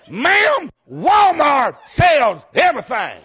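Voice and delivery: high, loud, slow mock-indignant shout; hammed-up naive husband, shouted. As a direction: insist it at the top of your voice. Retelling his naive reply, shouting.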